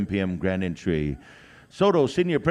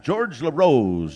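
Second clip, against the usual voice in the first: drawn-out announcer call; 2 semitones higher, 4 dB louder, slower than usual. Real, not acted. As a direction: stretch the name out loud and slow like a powwow MC. MC calls name, then repeats.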